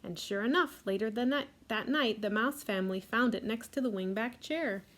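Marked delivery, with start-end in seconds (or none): none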